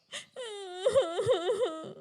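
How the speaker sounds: drawn-out sobbing wail; a little crow's cry; bawling child-like; whimpering sob. Acted. Hungry crow weeps in forest.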